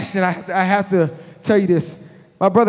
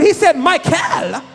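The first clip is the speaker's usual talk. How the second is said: incredulous; 5 semitones higher, slower than usual. Real, not acted. Incredulous repeat.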